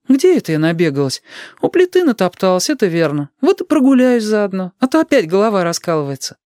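high-pitched mother's voice; high, chatty mum; busy mother. A narrator's reading. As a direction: pitch it up as a mum making light of her tiredness.

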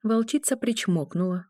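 ordinary delivery, nothing marked

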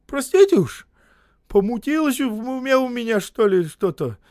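high, loud guilty lament; high loud guilty wail; guilty old man. A narrator's reading. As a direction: plead it high and sorry. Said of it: "виновато спрятав глаза".

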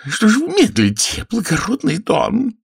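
high, breaking shriek of an impatient lady; very high, squealing demand; screechy cracking shout. A narrator's reading. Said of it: "визгливым, срывающимся голосом закричала".

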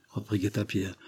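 usual speaking voice

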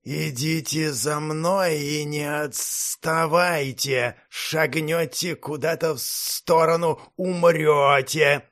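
slow, metallic inhuman command. Acted. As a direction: ring each word like metal. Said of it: "металлическим голосом сказал полузмей".

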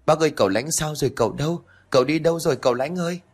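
high, fast, panicked search calls; frantic calling. Acted. Girl waking and searching the house for her master, frantic high calls. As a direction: call out desperately for him. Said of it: "tôi hốt hoảng bật dậy".

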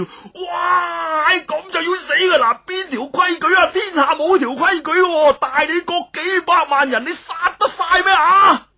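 very high wailing shout; crying, screaming protest; desperate loud cry. Acted. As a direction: scream and wail as you're dragged away. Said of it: "一味大声叫".

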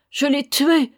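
grieving mother crying out; anguished cry. A narrator's reading. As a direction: cry out in despair. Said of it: "criait-elle".

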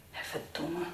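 low, soft swearing to oneself; quiet, annoyed aside; muttered curse. Acted. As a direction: curse under your breath. Cursing to self during call.

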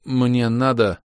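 struggling to move a swollen tongue; forcing words past a heavy tongue. Acted. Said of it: "С трудом заставив распухший язык шевелиться".

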